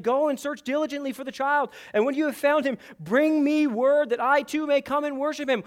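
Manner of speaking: a hint of a false, flattering king; mock-pious Herod. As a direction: quote Herod with fake sincerity.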